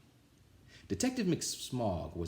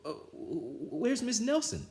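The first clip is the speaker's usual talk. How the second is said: put-on timid kids; 11 semitones higher, slower than usual. A narrator's reading. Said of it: "asked the kids".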